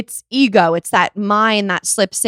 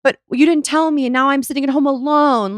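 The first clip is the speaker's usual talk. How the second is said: higher-pitched, hurt protest; mock plaintive complaint; 5 semitones higher than usual. Wife imitating husband who wasn't told her plans, plaintive. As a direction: imitate a partner whining about being left out.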